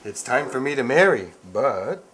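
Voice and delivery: hammed-up prince. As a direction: play a proud young prince. Dad plays prince.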